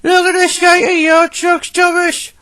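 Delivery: very high, scornful engine voice; haughty high-pitched character voice; James sneering. Acted. James scorns Thomas's messy trucks.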